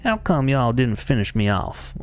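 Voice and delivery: put-on Texan accent, drawn out; game character voiced as a Texan; Milda. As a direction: play it with a slow Texan twang. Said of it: "I'm doing a Texan accent".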